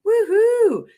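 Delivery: high loud woohoo; excited cheer. Real, not acted. Host cheering as live viewers join, excited.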